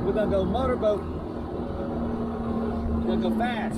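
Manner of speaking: shouting over motor. Real, not acted. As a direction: call out over a roaring motor.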